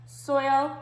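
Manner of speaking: Soil, the oi stretched and clear; oi sound stressed. Shown as a demonstration. Teacher modelling the oi sound for W group. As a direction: say Soil with a bold oi. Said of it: "oi, oi".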